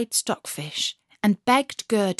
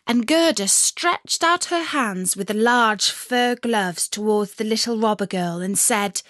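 ordinary delivery

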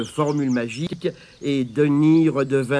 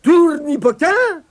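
incanting a spell; 10 semitones higher, 3 dB louder than usual. Acted. Abigail recites magic formula.

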